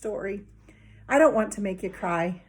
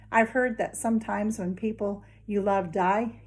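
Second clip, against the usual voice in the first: ordinary delivery, nothing marked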